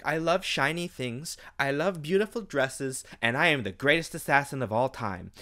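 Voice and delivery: high-pitched, self-adoring mock voice; hammed-up vanity, gushing over herself; vain Selena. Mocking the terribly written heroine who loves dresses, vain gushing. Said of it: "Selena is incredibly vain".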